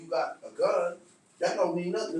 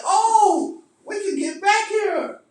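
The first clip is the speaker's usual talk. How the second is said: high, loud taunt that fades out; mock yell at the protesters; mock taunting shout; 8 semitones higher, 6 dB louder, slower than usual. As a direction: taunt them, voice high and raised. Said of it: "Now, I say".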